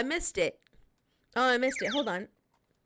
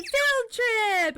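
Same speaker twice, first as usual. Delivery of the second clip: excited Maya; 13 semitones higher, 5 dB louder than usual. Retold or imitated. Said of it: "Maya's just like".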